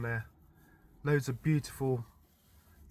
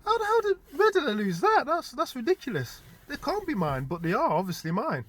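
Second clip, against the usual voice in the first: higher, louder mock panic; baffled disbelief; 12 semitones higher, 6 dB louder than usual. Patting his pockets, retelling his own shocked panic. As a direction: act out frantic pocket-checking disbelief. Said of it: "I was like".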